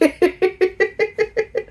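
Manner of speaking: high giggling; giddy high laugh. Real, not acted.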